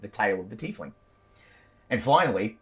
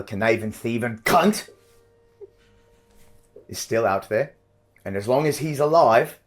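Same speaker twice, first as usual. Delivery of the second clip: louder, menacing patron giving orders; commanding patron voice; 2 semitones higher, 5 dB louder than usual. Acted. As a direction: threaten the mercenaries like a cruel fey patron. Patron voice in their minds.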